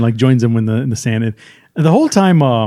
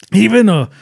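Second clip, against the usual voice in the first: high-pitched mock romantic villain; play-acted flowery declaration; poetic bandit; 6 semitones higher than usual. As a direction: recite it like hammed-up movie poetry. Voicing bandit's poetic line.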